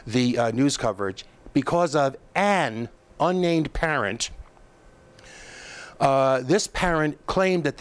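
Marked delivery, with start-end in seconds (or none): 1.5-4.3 "an" drawn out slowly and set apart; slow down and lean on "an"; stress on AN. Real, not acted. Host pointing at one unnamed parent, stretched emphatic "an". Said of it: "I emphasize that word".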